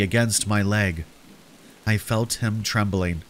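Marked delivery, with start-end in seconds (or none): none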